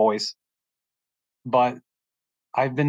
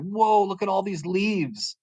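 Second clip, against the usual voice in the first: higher and slow, wide-eyed wonder; amazed whoa; 8 semitones higher, slower than usual. Retold or imitated. A teen with his first glasses, gasping in higher, slow wonder. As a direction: marvel at the leaves as if seeing them for the first time. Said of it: "I was like".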